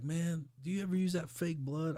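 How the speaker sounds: high, curious voice of a clueless coworker; naive coworker. Retold or imitated. Coworker asking about fake blood, high naive voice.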